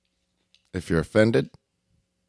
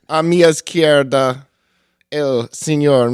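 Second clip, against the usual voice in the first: slow, loud ring introduction; lucha libre announcer; 3 semitones higher, 7 dB louder, slower than usual. Pretend. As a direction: drag out each name like a wrestling emcee. Introducing cohosts, announcer style.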